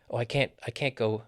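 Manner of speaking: CAN'T with a full vowel and firm beat; stress on CAN'T. Shown as a demonstration. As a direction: put the weight on CAN'T. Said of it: "we stress the negative".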